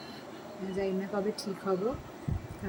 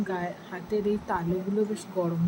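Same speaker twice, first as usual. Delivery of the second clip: ordinary delivery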